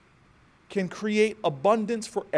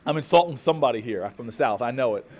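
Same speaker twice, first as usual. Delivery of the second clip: ordinary talk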